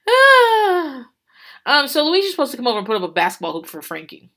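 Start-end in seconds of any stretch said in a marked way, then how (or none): 0.1-1.1 long high "ah" after laughing; high loud laughing sigh. Real, not acted. Podcaster recovering from laughing at Luigi, long high sigh.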